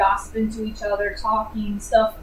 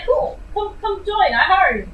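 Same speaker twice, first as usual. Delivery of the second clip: imitating the king; 8 semitones higher than usual. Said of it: "he'd be like".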